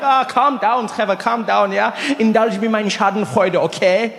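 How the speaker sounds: put-on German-accented placating; Rolf. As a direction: soothe teasingly in a German accent. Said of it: "He's like".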